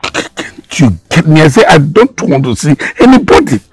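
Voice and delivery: loud, high and fast outburst; angry shouting. Acted. Father refusing visitors to his daughter, angry shouting. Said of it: "I shouted at you".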